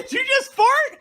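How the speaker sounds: high, loud laughing disbelief; laughing shout. Real, not acted. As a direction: shriek it through laughter. Laughing shock at fart.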